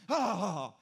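loud mock kid's frustrated grunting; hammed-up angry little-boy grunts; imitated frustrated grunts. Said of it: "When he get frustrated".

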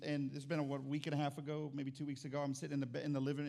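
usual speaking voice

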